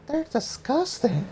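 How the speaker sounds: put-on high, disgusted girl; high young woman voice. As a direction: say it high with distaste.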